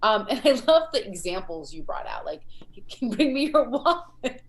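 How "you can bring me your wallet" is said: lightly hammed-up quote, pitched higher; playful imitation. Host quoting a comedian's absurd examples back to him, playfully imitating. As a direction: toss it out as an absurd request.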